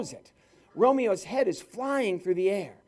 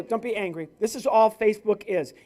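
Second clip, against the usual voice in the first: ordinary talk, nothing marked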